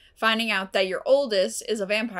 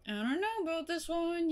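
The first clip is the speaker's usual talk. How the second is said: wary mom; 8 semitones higher, 6 dB quieter than usual. Retold or imitated. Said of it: "she's always just like".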